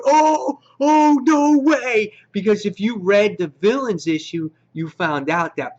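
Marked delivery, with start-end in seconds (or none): 0.0-2.1 much higher, louder, slow drawn-out "no way"; stunned disbelief. Retold or imitated. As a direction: stretch it out in shocked delight. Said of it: "I'm sitting there going".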